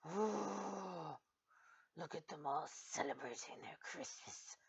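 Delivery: low, hushed grumbling; quiet, sneering imitation; mock resentful neighbour. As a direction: mutter it with fake disdain. Imagined resentful immigrant.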